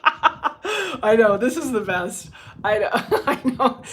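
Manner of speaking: laughing delight with her viewers. Real, not acted. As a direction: giggle through the words at the chat. Laughing with chat.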